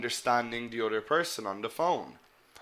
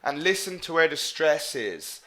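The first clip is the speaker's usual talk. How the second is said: ordinary talk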